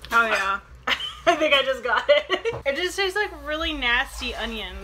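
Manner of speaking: speech broken by laughter; amused giggly delivery; laughing while speaking. Real, not acted. Seen 1: Open laughing smile throughout; no chewing or candy in mouth visible.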